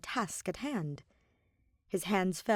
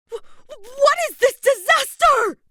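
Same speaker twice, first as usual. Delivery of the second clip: shrill top-of-lungs scream, much higher; 13 dB louder than usual. A narrator's reading. Queen horrified in the garden, screaming high and loud. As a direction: scream in horror. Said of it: "he screamed at the top of his lungs".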